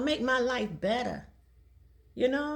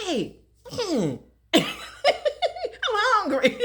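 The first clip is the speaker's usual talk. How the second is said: laughing groan; 10 semitones higher, slower than usual. Retold or imitated. Hungry driver sees tire light.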